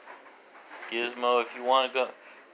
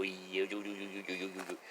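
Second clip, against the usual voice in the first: much softer, fading into a whisper; fading coo; 3 semitones lower, 11 dB quieter, much faster than usual. Real, not acted. Owner cuddling her dog, fading whispered coo. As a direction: murmur it closer and quieter.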